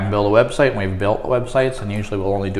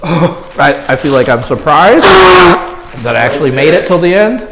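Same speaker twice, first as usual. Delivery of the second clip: relieved and proud; 5 semitones higher, 7 dB louder, slower than usual. Real, not acted. Relieved after finishing the wings.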